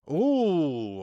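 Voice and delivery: loud, long ooh falling from high pitch; big drawn-out ooh at a near chance; excited ooh. Real, not acted. Fan reacting as Arsenal threaten, loud excited ooh.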